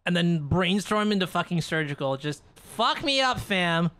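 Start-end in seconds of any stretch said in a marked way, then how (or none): none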